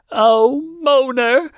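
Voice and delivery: high, loud joyful cry of a name; excited exclamation. A narrator's reading. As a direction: call out in relief and delight. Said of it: "she exclaimed".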